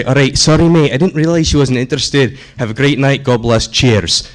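put-on a light, friendly Scottish accent. Scottish Josh apologizing to the bro in a friendly Scottish accent. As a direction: back off politely in a Scottish accent. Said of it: "But as Scottish Josh, you say".

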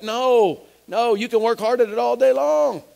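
rousing preacher building point on point; full-force preaching. Real, not acted. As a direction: preach it hard, punching key words.

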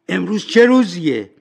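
high, loud eager question; calling out. Acted. Scrooge at the window asking a boy the day, calling out. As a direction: call down to someone in the street.

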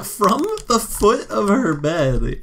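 ordinary delivery

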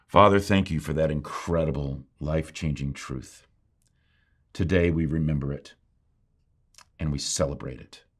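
low, measured prayer voice; closing prayer. Real, not acted.

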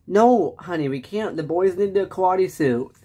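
usual speaking voice

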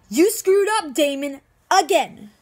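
loud, high tirade building to a peak; exasperated, heated outburst; furious blame. Acted. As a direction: hammer each reproach, climbing to a shouted confession. Acted furious blame at Damon.

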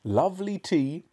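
high, cheery coaxing; grinning broadly. A narrator's reading. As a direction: sell it with a huge grin. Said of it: "grinning hugely".

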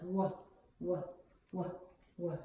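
"Wah" as a short wuh, spaced apart; letter sound demo. Shown as a demonstration. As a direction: sound out "Wah" four separate times. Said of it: "Willy Weasel says".

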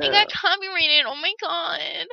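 high, loud fake crying; mock wail. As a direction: overplay the tragedy.

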